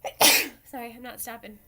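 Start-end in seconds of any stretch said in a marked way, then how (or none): 0.0-0.5 loud sudden sneeze. Real, not acted. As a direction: sneeze mid-sentence. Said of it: "I'm gonna sneeze".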